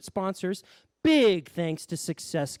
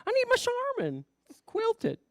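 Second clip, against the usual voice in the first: much higher whiny protest; husband whines about his favourite toilet paper; 7 semitones higher, 4 dB louder than usual. Acted. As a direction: whine like a fussy child. Husband whining about brand.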